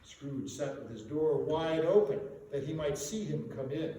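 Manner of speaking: ordinary delivery, nothing marked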